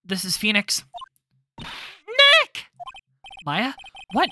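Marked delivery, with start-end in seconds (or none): 1.6-2.7 much higher, loud call; urgent cry. Acted. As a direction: grab his attention fast. Maya calling on phone.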